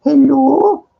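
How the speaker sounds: high falsetto imitation of a woman's voice; woman on the phone. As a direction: squeak it up high like the caller. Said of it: "I hear this woman's voice".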